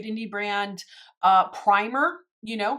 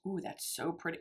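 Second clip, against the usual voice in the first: usual speaking voice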